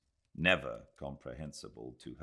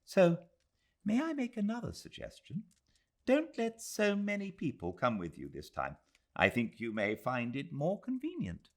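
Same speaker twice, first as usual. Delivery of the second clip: higher voiced elderly lady giving mild advice; gentle old lady, tactful and kind, pitched up; Mrs Moore, gentle elder; 13 semitones higher than usual. Mrs Moore advising Aziz.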